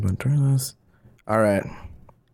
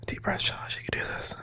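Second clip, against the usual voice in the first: whispered pep talk to himself; whispering; 8 semitones higher, 9 dB quieter than usual. Real, not acted. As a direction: whisper it under your breath. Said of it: "psyching himself up".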